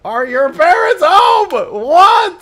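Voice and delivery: very high, very loud screech; shrieking disbelief. Retold or imitated. Streamer screaming the salesman's question in mock outrage.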